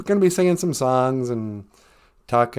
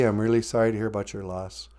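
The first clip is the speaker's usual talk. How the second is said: normal talking voice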